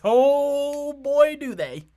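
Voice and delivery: high, booming exclamation; loud mock agreement. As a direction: holler it in exaggerated agreement. Mock-emphatic reaction to the article.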